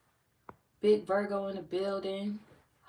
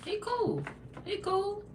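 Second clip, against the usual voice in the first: high squeaky; slower than usual. Real, not acted. High-pitched repeat of praise.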